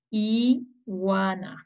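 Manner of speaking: "Iguana" in slow parts, long I, then "guana"; sounding out. Shown as a demonstration. Teacher asks children to help read, "Iguana" sounded out slowly. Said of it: "¿Me ayudan a leer?".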